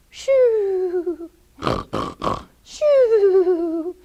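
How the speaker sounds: comic snore with high whistled "shoo"; snoring. Acted. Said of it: "He was actually snoring".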